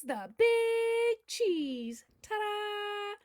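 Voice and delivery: "big" stretched high and loud, then a punchy "You better believe it"; big showman refrain. Acted. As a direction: belt the catchphrase to the back row. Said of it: "Oh, say it with me, please".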